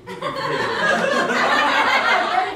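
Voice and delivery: high, slow, laughing cooing; high mock sweet coaxing. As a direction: coo it like a cheesy romance. Mock romantic encouragement.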